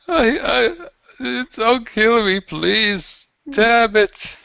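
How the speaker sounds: high, panicked begging captive; frightened pleading. Acted. Captive begging his captors not to kill him, panicked. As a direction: beg for your life, voice up high.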